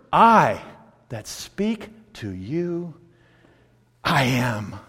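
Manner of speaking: low, slow and soft; hushed, measured declaration; solemn revelation. Retold or imitated. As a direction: leave weighty space between the phrases. Voicing Jesus revealing himself.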